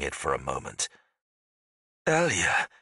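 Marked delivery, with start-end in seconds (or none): 2.1-2.7 higher, searching call of a name; groping for an answer. A narrator's reading. Ghost trying to recall a name, groping and uncertain. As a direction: reach out for a half-remembered name. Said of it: "groping for an answer".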